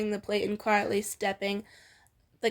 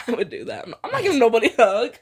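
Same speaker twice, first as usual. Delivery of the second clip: fast, loud laugh-through line; can't stop cracking up; real giggling outburst; 8 dB louder, much faster than usual. Real, not acted. Actor breaks laughing.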